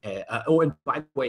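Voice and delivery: usual speaking voice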